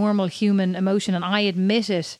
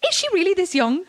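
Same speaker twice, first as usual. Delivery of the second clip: high-pitched incredulous question; mock disbelief; 10 semitones higher than usual. Texting a friend about her nemesis's birthday, mock disbelief. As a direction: squeak it out in playful outrage.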